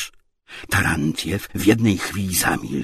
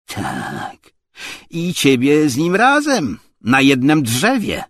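loud, high burst of loathing; hateful; 8 semitones higher, 7 dB louder than usual. A narrator's reading. Tarantiew threatens to hang Obłomow too, hateful. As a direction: threaten with venom, rising loudly. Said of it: "z nienawiścią chrypiącym głosem".

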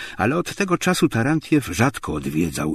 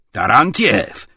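loud, raised warning call; menacing shout; 5 semitones higher, 7 dB louder, slower than usual. A narrator's reading. Obłomow stops Tarantiew's insults, menacing shout. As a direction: cut him off threateningly. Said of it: "krzyknął groźnie Obłomow".

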